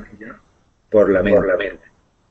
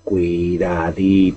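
slow, drawn-out warning, a touch ominous; slow spooky warning; 4 semitones lower, much slower than usual. Pretend. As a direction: stretch the word out like a creepy warning. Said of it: "Digo otra vez cuidadito".

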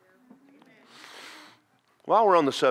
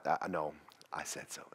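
put-on whispered gentle no to a child; kind whisper; 6 semitones lower, 11 dB quieter than usual. Shown as a demonstration. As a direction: whisper it softly so they listen. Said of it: "look at them real kindly and go".

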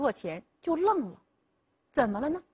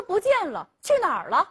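mild alarm; 5 semitones higher, 4 dB louder, faster than usual. A narrator's reading. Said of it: "黄老汉一下子就急了".

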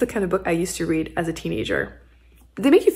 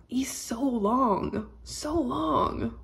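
drawn-out, adoring and softer; slow doting coo; 4 semitones higher, 6 dB quieter, much slower than usual. Real, not acted. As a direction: linger on long, lovingly. Cooing over the kitten, slow.

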